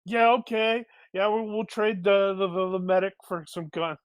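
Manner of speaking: high mock acceptance of a deal; acted eager agreement. Party agreeing to trade medic for guns, high mock agreement. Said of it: "we're like".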